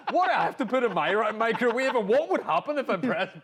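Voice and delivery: high, loud retold wonder; captured young kid. Recalling childhood microwave fantasy, high excited wonder. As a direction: relive a kid's excited fascination, high and loud. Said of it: "as a young kid, just captured by that idea".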